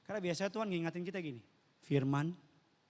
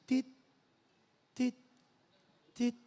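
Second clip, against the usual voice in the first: short, pitched monitor beeps; machine beep; 8 semitones higher, 5 dB louder than usual. Retold or imitated. Preacher imitating the ICU machine, short beeps. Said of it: "udah bunyi mesin".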